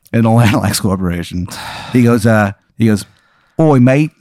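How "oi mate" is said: mild, hammy Cockney put-on; a light bad London accent; terrible English accent. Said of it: "Yeah, it's a terrible accent".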